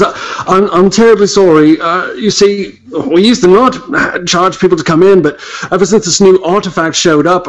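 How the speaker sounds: a British clerk explaining the fee, sorry; lightly done British accent slipping. Acted. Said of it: "I'm losing my accent".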